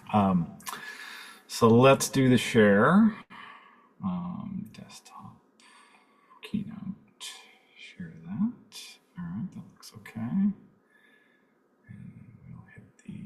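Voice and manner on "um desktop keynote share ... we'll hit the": very soft, half-whispered self-talk; muttering to self. Real, not acted. Presenter talking himself through sharing his screen, muttering to self. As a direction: murmur the steps under your breath.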